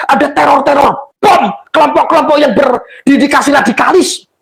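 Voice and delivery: high, forceful indignant tirade; impassioned protest. Real, not acted. Impassioned protest addressed to the police. (protes berapi-api, nada tinggi)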